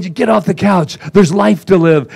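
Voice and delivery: loud, high motivational coach voice; Tony Robbins pep talk. Retold or imitated. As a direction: fire up the couch potato at 2 AM. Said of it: "And there was Tony".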